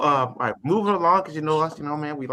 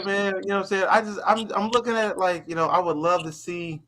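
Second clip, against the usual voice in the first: ordinary talk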